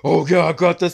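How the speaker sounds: loud, high mock moaning impression; Kitty. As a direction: put on a loud, hammed-up voice. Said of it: "when Kitty had the nerve to go".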